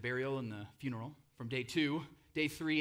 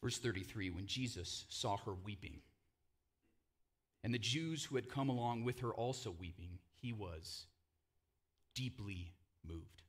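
hushed scripture reading; 6 semitones lower, 5 dB quieter than usual. Acted. Pastor reading the grief scene to the congregation, softly.

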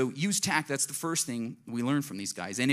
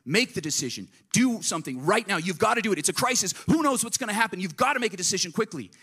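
higher, louder, pressing voice of urgency; urgent panic; 7 semitones higher, 4 dB louder than usual. Retold or imitated. Pastor voicing crisis pressure to decide, urgent and loud. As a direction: push hard like everything is on fire. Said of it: "you feel this urgency".

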